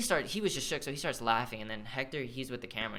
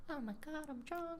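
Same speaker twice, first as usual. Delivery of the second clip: high, soft squeal imitating a drunk girl; mock drunk girl; 10 dB quieter than usual. Storyteller mimicking a drunk girl talking to the camera, high mocking voice.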